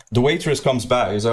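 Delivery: ordinary talk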